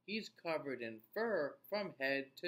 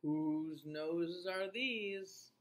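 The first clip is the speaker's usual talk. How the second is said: riddle voice; 3 semitones higher, much slower than usual. Acted.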